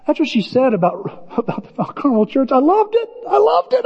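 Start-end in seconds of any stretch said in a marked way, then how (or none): 2.5-3.8 high, gushing imitation of the girl's delight. Pastor imitating Emily gushing about the church, high and delighted. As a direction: squeal it like she's thrilled.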